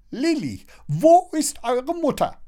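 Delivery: very high busybody voice; high-pitched, pressing question; insistent neighbour. A narrator's reading. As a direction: demand it nosily. Neighbour keeps pressing at the door.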